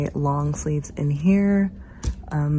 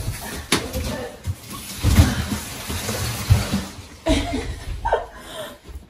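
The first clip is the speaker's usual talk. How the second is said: long high squeal of laughter. Real, not acted. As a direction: let out a long giddy squeal. Laughing at scared friend.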